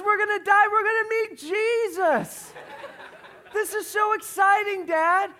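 put-on gleeful high, loud child's shout; imitated little girl thrilled by disaster; happy about it. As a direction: shout it joyfully, high and ecstatic. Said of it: "But she's happy about it".

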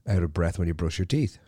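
normal talking voice

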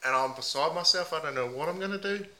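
a lightly put-on Aussie bloke accent, mate on every line. Host playing a heartbroken meth packer in a mild put-on Aussie accent. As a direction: ham up the ocker Aussie, leaning on mate. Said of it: "put on a few different voices".

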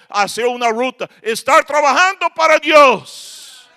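loud, driving sermon cadence; preacher building to a loud peak; fiery preaching. Acted. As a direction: pound each phrase at the congregation. Preaching rebuke to congregation.

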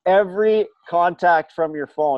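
can barely get the clerk's words out for laughing; amused retelling of a store clerk; laughing through quote. Retold or imitated. Retelling Apple guy's bad news.

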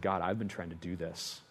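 very low, hushed confession; defeated prayer. Retold or imitated. Defeated minister confessing to God, hushed and low. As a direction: pray it quietly, drained and low.

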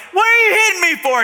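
high, loud, fast mock protest; indignant shout. Donkey protesting to the man beating it, indignant mock shout. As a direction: yell it in hammed-up outrage.

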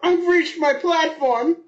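high, loud, slow mock moan; mock climax. Joining the joke, a high loud mock moan. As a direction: drag it out as a fake climax.

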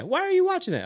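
high-pitched mock mom voice; put-on high, disapproving parent; mock questioning mom. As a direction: question the kid in an acted-up high voice. Acting out mom questioning the kid.

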